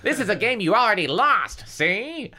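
high, raspy gunman's threat; hammed-up hoarse villain; hoarse rasp. A narrator's reading. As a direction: rasp it with menace. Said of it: "rasped in his hoarse voice".

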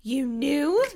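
high, loud, rising question; shocked cry. A narrator's reading. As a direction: cry it in disbelief. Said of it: "Dina cried".

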